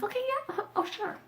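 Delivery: very high, softened mock acceptance; gullible agreement. Mocking her own drunken acceptance.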